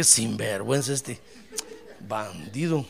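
low, soft scolding under the breath; grumbled aside. Retold or imitated. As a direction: grumble it to yourself, dropping low. Retold private grumble at friend. (refunfuño bajo y grave)

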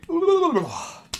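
long loud high yawn; big yawn. Real, not acted.

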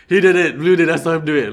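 loud, high, urgent blame; acted panicked accuser; frantic accusation. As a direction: point the finger in a panic.

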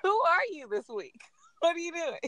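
ordinary talk, nothing marked

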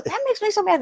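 high-pitched mock exasperation; mad delivery man. Delivery man complains to the secretary, mock angry. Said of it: "he got mad at me".

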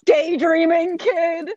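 slow loud shout; outraged yell. Real, not acted. Outraged at the story.

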